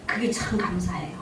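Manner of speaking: a hushed, heartfelt whisper; whispered thanks. Real, not acted. Preacher whispering her thanks to God, to the congregation. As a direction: breathe it out in a real whisper. Grateful aside in sermon.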